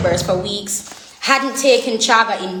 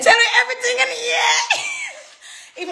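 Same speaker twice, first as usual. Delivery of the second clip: laughing shout; 14 semitones higher, slower than usual. Real, not acted. Laughing shout while telling the viewers everything.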